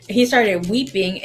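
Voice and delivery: usual speaking voice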